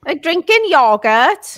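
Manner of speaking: high, loud Welsh lilt; Swansea accent. Shown as a demonstration. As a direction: drawl it slowly in thick Swansea Welsh. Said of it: "say it with your Swansea accent".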